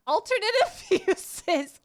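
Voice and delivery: high, loud word through laughter; reading while giggling; giggly read-out; laughing. Real, not acted.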